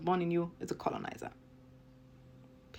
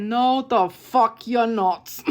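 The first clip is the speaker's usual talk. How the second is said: much louder, slow, drawn-out No; loud rebuttal; 4 semitones higher, 9 dB louder, much slower than usual. Real, not acted. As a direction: slam the denial down hard. Furious rebuttal.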